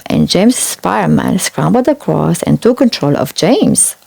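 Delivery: plain reading voice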